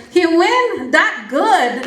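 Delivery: put-on proud mom in a Belizean accent. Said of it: "In her Belizean accent".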